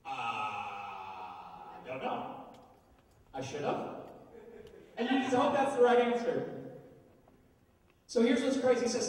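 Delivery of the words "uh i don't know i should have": much lower and softer, slow and unsure; sheepish mumble. Pretend. Student caught by God, sheepish slow mumble. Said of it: "you're like".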